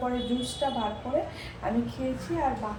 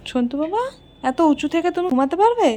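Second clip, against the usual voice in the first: higher and much louder, coaxing a pet; coaxing pet talk; 6 semitones higher, 12 dB louder than usual. Real, not acted. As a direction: sweet-talk the pet, high and loud.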